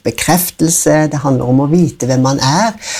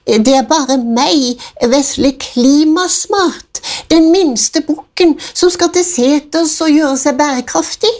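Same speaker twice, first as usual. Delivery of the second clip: tiny goat voice; 11 semitones higher than usual. A narrator's reading. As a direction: play the smallest goat, small and timid. Smallest goat answers troll.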